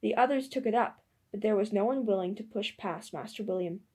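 ordinary delivery

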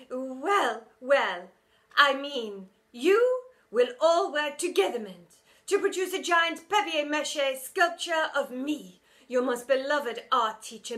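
loud, high self-praising teacher; vain teacher. A narrator's reading. Teacher assigning her own statue, loud and vain. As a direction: savour your own name.

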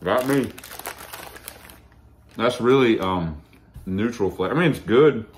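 talking between bites; speaking while eating snack; mouth full, chewing. Real, not acted. Seen 3: Man bites snack at 1.4, keeps chewing through the words.

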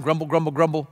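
low, soft grumbling mutter, falling at the end; mock grumble, low and soft.

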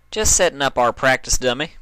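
bright friendly country goodbye; country mare. Acted. Applejack saying goodbye to Dash, high and cheery.

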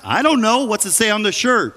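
high-pitched holler back down the stairs; yelling back. Retold or imitated. Wife upstairs answers husband about the wash, yelling back. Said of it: "yelled back".

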